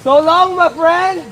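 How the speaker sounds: loud, high, drawn-out goodbye call; mock dramatic farewell. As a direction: call out a hammed-up farewell, slowly. Mock dramatic farewell to departing friend.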